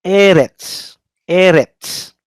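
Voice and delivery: normal talking voice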